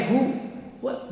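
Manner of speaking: normal talking voice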